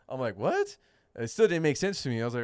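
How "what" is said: high, rising incredulous yelp of a word; retold disbelief. Angler recalling his homie's explanation, high-pitched mock disbelief. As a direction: shoot the pitch way up, mock baffled. Retells his baffled reply.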